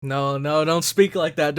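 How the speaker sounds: usual speaking voice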